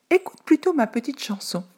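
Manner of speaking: tiny panicked voice; frightened little pancake. A narrator's reading. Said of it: "dit la galette".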